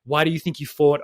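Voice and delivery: usual speaking voice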